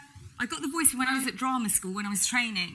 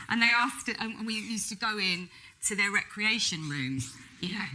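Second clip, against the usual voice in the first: normal talking voice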